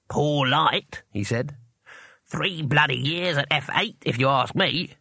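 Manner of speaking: slow, odd little demon voice, grumbling; a cranky tiny painter demon; grumpy imp. A narrator's reading. The imp poking out of its box, slow and grumbling.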